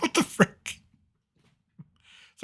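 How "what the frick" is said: high-pitched, softer mock bafflement; retold disbelief. Store manager recalling the sudden walkout, baffled. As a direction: squeak it out as an incredulous aside.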